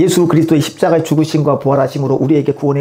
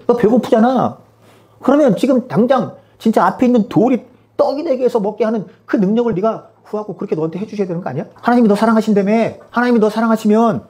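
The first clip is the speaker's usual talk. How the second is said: higher, goading devil's voice; tempting devil; 5 semitones higher than usual. Acted. Devil tempting God's child to demand things, goading. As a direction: needle them with pushy leading questions. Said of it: "마귀는 끊임없이 우리를 유혹합니다".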